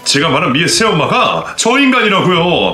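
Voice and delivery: high, strained furious shouting; furious yelling. Acted. Son yelling at the mother who abandoned him, furious. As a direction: rage at her, voice cracking high.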